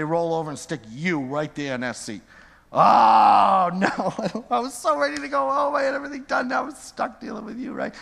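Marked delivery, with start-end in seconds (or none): 2.7-7.9 mock dismay. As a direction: act out the tired server's exasperation. Server voicing stuck waiter's dismay.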